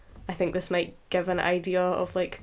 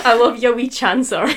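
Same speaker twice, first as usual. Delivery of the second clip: wee chancer said much louder with a Scottish relish; gleeful Scottish wee chancer with a laugh; relishing Scottish accent; 4 semitones higher, 12 dB louder than usual. Shown as a demonstration. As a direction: savour wee chancer loudly in broad Scots. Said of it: "it's so fun to say".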